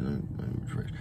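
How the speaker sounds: a whispered sly correction; whispered aside. Real, not acted. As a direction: drop to a whisper to correct yourself. Jokey self-correction aside.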